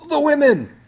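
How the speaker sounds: high, loud mock scream. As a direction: belt it out like Spock. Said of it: "with Spock screaming".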